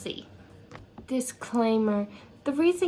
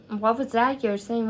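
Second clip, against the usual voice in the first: ordinary talk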